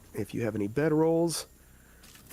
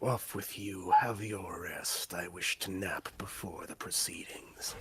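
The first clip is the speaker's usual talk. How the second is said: whispery hobgoblin; 5 semitones lower, 5 dB quieter than usual. Acted. Hobgoblin warning the party about goblins, low whisper. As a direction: hush it like a sly creature.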